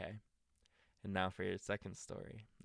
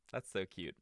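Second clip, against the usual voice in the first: plain reading voice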